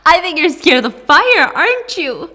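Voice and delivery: high, loud mocking taunt; gloating taunt. Mom taunts daughter who fears fire, gloating. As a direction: crow it loudly at the victim.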